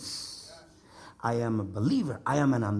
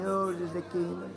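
softer, monotone droned delivery; mock recitation; 3 semitones higher, 4 dB quieter than usual. Shown as a demonstration. Preacher teasing the congregation's response, mock droning recitation. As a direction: drone it on one note, held back. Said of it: "like you ate well, you know".

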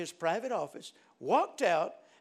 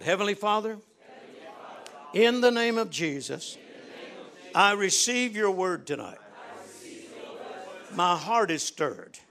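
led prayer; 5 dB louder than usual. Acted. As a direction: lead them in prayer, line by line. Leads congregation in prayer.

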